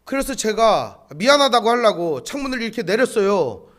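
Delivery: usual speaking voice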